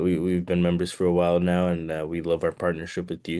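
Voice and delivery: ordinary talk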